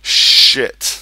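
loud, explosive unvoiced curse; frustrated curse. Real, not acted. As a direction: spit it out at the ruined house. Finds his house destroyed.

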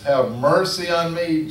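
calling out. Retold or imitated. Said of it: "He says".

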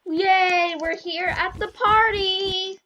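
loud, high happy cheer; excited cheer. Real, not acted. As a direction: burst in delighted at the party. Arrives at the party.

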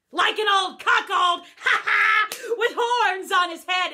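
loud, laughing jeer; loud gleeful cackle; laughing mockery. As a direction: hoot at the old cuckold. Mocking the cuckold image, laughing.

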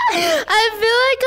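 high, breaking into laughter; real laughing. Real, not acted. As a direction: laugh helplessly into the words. Laughing after the boss fight bit.

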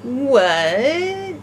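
long baffled "What?" as William; "What?" stretched out, bewildered. Shown as a demonstration. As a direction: drag out "What?" in a confused puppet voice. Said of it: "I should have said".

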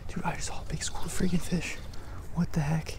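hushed excited whisper, breath only; amazed whisper at the water's edge. Real, not acted. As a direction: whisper so the fish don't spook. Quiet near school of fish.

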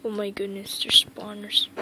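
hushed unvoiced whisper; sneaky whisper. Real, not acted. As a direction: whisper so nothing hears you. Sneaking, spots spawners.

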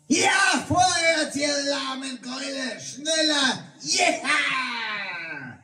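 hoch und laut, treibt die Pferde an; brüll die Gäule vorwärts; anfeuernder Ruf. Acted. (hoher anfeuernder Schrei)

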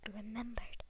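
soft whispered repeat; whispering. Real, not acted. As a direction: whisper it to yourself.

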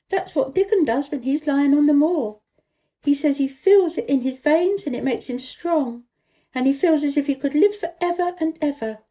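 high, lively coaxing; eager girl. A narrator's reading. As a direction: urge him on eagerly. Mary urges Colin to breathe.

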